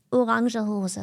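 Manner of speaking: orange hose as o-RAHN-zhuh HO-zuh; German pronunciation. Shown as a demonstration. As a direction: sound both final e's in orange hose. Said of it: "You literally have to say".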